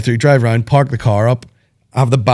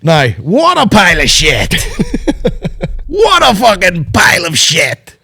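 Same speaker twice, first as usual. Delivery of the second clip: higher, slow scornful verdict; disgusted verdict; 8 semitones higher, 4 dB louder than usual. Real, not acted. Dismissing a film to his co-host, slow scornful. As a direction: savour how bad it was. Ranting about the film in filter.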